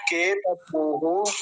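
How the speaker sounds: केतपूः with the ऊ stretched long; very slow केतपूः, long final ऊ. Shown as a demonstration. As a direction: pull the ऊ in केतपूः out slowly. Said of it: "लंबा खींचेंगे क्योंकि पकार पर दीर्घ ऊकार है".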